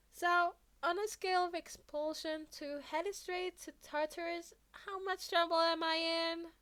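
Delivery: bracing for the punishment; sheepish kid. Acted. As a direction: Midoriya asking his teacher about trouble. Midoriya asking sheepishly.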